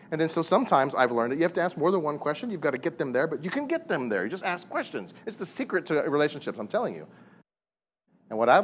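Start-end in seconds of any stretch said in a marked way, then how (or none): none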